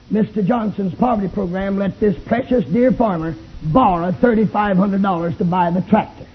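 ordinary talk, nothing marked

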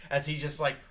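ordinary talk, nothing marked

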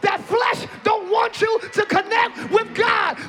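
loud, rising preacher's cry; shouting sermon climax to the congregation; full-force preaching. Real, not acted. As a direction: preach it with everything you've got.

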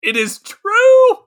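very high, slow, loud sing-song; high drawn-out mock agreement. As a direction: stretch it out in a silly high voice. Jokey agreement on Gosling love.

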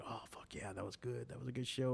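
soft pleased murmur to himself; quiet elated self-talk. Retold or imitated. Comic to himself after a good set, a soft elated murmur. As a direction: hush it like a private win.

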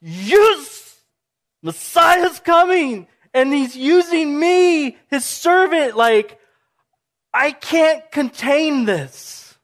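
high, loud bursting joy, slowing at the end; can't hold the joy in; overjoyed Mary. Retold or imitated. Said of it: "she's like".

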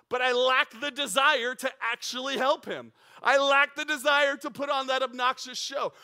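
loud, high, driving sermon punch; fervent preacher's crescendo; preaching at full force. Acted. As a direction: hammer the point home to the congregation.

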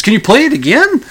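high, pleading caller voice; mock eager fan on the phone. Caller begs DJ replay.

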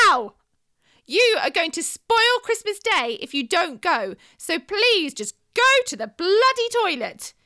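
yelling at full volume, high and slow at first; furious shout. Retold or imitated. Mother screaming at constipated toddler on Christmas Eve, furious shout. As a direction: lose it and scream at the child. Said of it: "I shouted".

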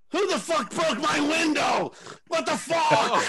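high, slow furious shout; imitating an enraged roommate yelling; angry roommate. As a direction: roar at the broken window. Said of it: "he sees the glass and goes".

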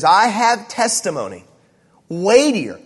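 "weightier" set apart, high and loud; slow, weighty stress on "testimony" and "weightier"; emphatic declaration. Acted. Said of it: "all the language here in verse thirty-six is emphatic".

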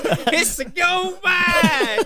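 slow, high yelled gold-strike cry; drawn-out high prospector shout; old prospector. Pretend. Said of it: "Is that your old prospector?".

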